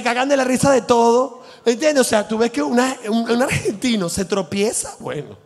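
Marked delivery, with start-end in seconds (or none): none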